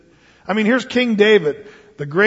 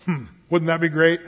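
normal talking voice

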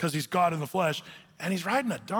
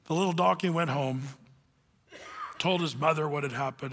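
normal talking voice

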